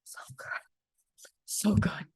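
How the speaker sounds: fluisterend genietend; whispered savoring. Real, not acted. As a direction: fluister het vol genot. Savoring memory, unvoiced.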